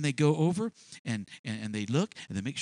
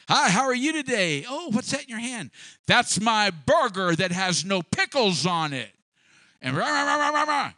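loud, higher, ranting clerk; mock angry clerk; 4 semitones higher, 6 dB louder than usual. Preacher mimics a carnal clerk yelling at a customer. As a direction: rant back at the customer like a hothead.

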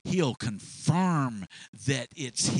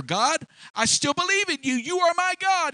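loud declaration; 6 dB louder than usual. Acted. Elijah declaring faith.

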